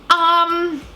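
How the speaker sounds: high, flustered stammer; squeaky, lost for words; high awkward fumbling. Retold or imitated. Herself flustered in story.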